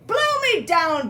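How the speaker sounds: high, loud pirate bellow; big theatrical roar. A narrator's reading. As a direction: bellow it like an outraged pirate captain. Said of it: "bellowed Pete".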